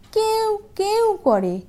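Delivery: high, slow whimpering like a scared puppy; whimpering dog. Acted. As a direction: whine it like a frightened dog. Frightened dog whimpering in story.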